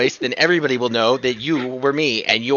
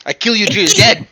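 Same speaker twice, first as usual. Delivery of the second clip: higher put-on threat in another character's voice; Olivia's voice; 6 semitones higher than usual. Impostor speaking perfectly in Olivia's voice, mock threat. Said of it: "Speaking perfectly in Olivia's voice".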